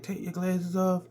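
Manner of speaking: put-on girl voice. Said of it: "she's like".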